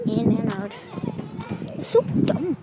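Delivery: ordinary talk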